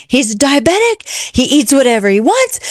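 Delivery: retelling the neighbor explaining her dog; imitated frantic neighbor. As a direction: play the flustered dog-sitter. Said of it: "And she's going".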